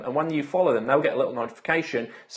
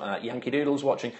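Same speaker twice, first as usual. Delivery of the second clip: ordinary talk, nothing marked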